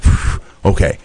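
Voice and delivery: breathy relieved sigh, then okay; relieved phew. Retold or imitated. Retold relief.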